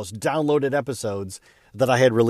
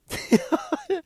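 a sudden high disbelieving laugh; high burst of laughter. Real, not acted. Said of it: "I laughed".

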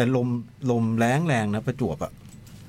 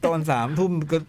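laughing; 6 semitones higher than usual. Real, not acted. Joking about ruined good day.

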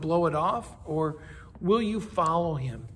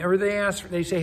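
ordinary talk, nothing marked

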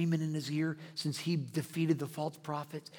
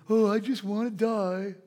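slow, louder, wailing Elijah; despairing Elijah; 4 semitones higher, 5 dB louder than usual. Retold or imitated. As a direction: moan it like a man who's given up. Elijah answers God in despair.

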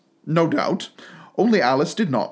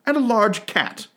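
usual speaking voice

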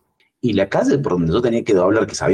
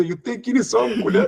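mucho más agudo y riendo; desafío entre risas. Retold or imitated. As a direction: pregunta burlona reída. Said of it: "yo los miraba como diciendo". (imitándose borracho, agudo y riendo)

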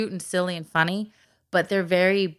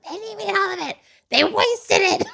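put-on much higher indignant child voice; imitating daughter; 14 semitones higher, 5 dB louder than usual. Mom imitating Anna upset the orcas wasted food, high indignant voice. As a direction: play the outraged little girl. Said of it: "And she's like".

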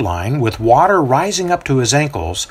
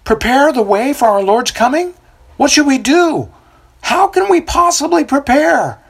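much higher, louder bewildered call; confused crowd yelling questions; 14 semitones higher, 3 dB louder than usual. A narrator's reading. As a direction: shout the question across the crowd. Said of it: "some yell out".

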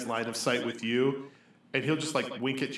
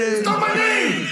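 high, drawn-out mock-outraged shout; yelling; 11 dB louder, slower than usual. [name] yelling at the Grand Maester into the hallway, outraged. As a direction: yell it down the hallway at full volume. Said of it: "He'll yell into the hallway".

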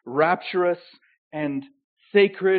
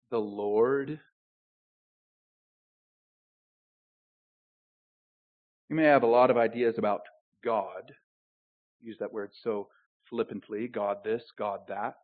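low, soft aside; hushed preaching; 7 semitones lower, 5 dB quieter than usual. Acted. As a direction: drop the voice to draw them in. Softer intimate preaching.

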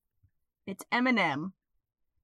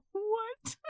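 surprised; 13 semitones higher, 6 dB quieter than usual. Retold or imitated. Shocked reaction quote.

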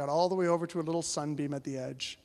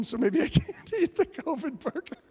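laughing; 8 semitones higher than usual. Retold or imitated. Laughs through his polite refusal.

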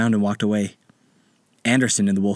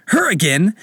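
flustered exclamation; 8 semitones higher, 5 dB louder than usual. Acted.

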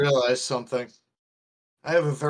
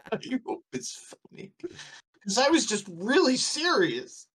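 laughing; 10 semitones higher than usual. Real, not acted.

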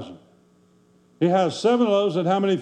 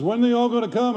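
higher-pitched imitation of a worried question; puzzled pastor; 6 semitones higher, faster than usual. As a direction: mimic the pastor asking, pitched up. Worried cowboy pastor's question retold.

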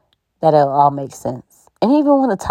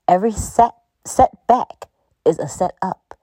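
slower emphatic repeat. Shown as a demonstration. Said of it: "I'm gonna say that again".

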